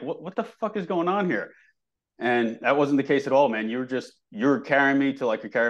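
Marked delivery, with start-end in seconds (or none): 0.0-1.5 high-pitched mock disbelief; baffled disbelief. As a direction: act stunned, voice jumping up high. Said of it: "I'm like".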